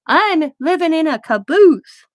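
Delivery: drawn-out, high and loud with glee; excited announcement. Retold or imitated. Teen telling grandpa about her caboose, thrilled and loud. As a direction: belt it out like big news. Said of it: "I remember telling my grandpa".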